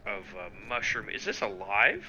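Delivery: usual speaking voice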